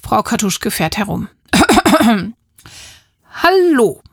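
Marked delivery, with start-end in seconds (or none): 1.5-2.3 loud, deliberate throat-clearing; loud staged cough. Acted. Mappa announcing himself to the neighbour, loud throat-clearing. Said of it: "Mappa hustet laut". 3.4-4.0 high, loud falling call; loud hail. Acted. Mappa greeting the neighbour, high loud call.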